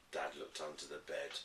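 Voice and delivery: ordinary delivery, nothing marked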